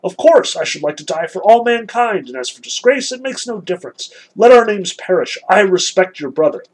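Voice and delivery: high, loud read-aloud proclamation; fervent declaration. A narrator's reading. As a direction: declaim it with youthful ardour. Kolya's fervent reply read aloud.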